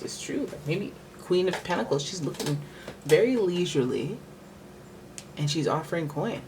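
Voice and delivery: usual speaking voice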